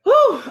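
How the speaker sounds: loud high exhale to recover; high loud whew after laughing. Real, not acted. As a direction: blow out the laughter.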